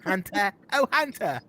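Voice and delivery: high, loud, hammed-up New Zealand "Han-tah"; mock New Zealand accent. Host butchering her character's lines, mock New Zealand accent. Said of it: "that have that accent".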